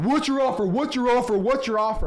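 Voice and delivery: imitated shouting seller that winds down; mock screaming seller. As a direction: repeat the demand, running out of steam. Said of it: "they're, like, screaming at me".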